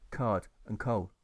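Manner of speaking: ordinary delivery, nothing marked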